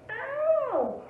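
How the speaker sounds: acted yelp of strain; pained ow. A narrator's reading. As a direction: cry out as if it hurts. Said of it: "grunted Douglas".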